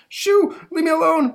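high, loud squealing crook; panicky pickpocket. Acted. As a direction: shriek like a crook on the run. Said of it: "says the, uh, the pickpocket running away".

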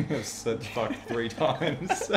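words through laughter; slow, laughing it out. Real, not acted. Laughing at own swearing.

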